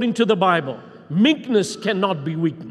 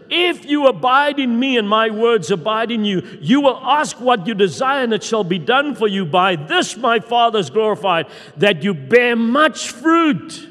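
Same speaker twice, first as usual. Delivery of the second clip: preaching; 4 semitones higher, 5 dB louder than usual. Acted. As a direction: proclaim the scripture like a herald. Quotes Jesus with preaching force.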